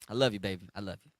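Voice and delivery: put-on soft, hushed "I love you, baby"; calming a spouse with soft love; tender to spouse. Soothing spouse instead of anger.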